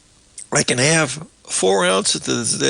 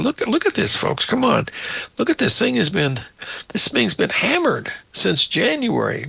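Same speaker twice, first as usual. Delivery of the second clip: exasperated; 7 semitones higher than usual. Real, not acted. Host pleading to viewers over gas prices, exasperated and higher. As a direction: pitch jumps higher in disbelief.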